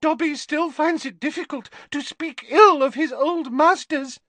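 a small elf confessing his struggle; squeaky elf. A narrator's reading. Dobby explains to Harry.

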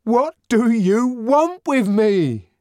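high, slow, desperate demand; anguished demand. A narrator's reading. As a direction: plead to be left alone. Savage repeats to the crowd.

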